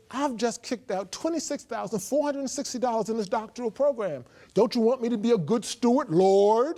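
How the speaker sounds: angry pleading while shoveling snow; mad at God. Retold or imitated. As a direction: argue your case to God, heated. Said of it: "I'm getting mad with the Lord now".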